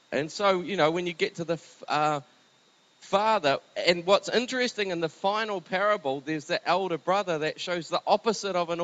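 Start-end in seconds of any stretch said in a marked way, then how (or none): none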